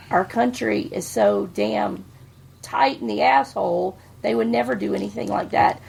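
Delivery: ordinary delivery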